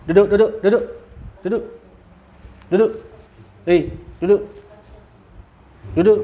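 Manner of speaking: sharp repeated order to sit; commanding. Real, not acted. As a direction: order the spirit down firmly.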